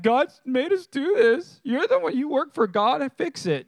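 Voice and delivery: high, whiny-child sulk, playfully acted; mock pouting. Preacher imitates the kings pleading with Elisha, mock pouting. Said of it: "they're still pouting".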